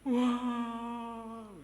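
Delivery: long, high mock bawl; imitated wail. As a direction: draw out a child's crying wail. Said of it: "ini nangis sungguhan dia". (tiruan tangis panjang melengking)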